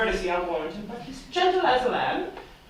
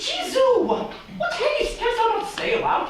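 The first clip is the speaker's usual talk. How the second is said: much higher, indignant exclaiming; exasperated squeal; 13 semitones higher than usual. Acted. Nurse exasperated, out of breath.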